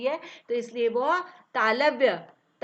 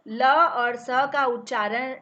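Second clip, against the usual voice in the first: ordinary delivery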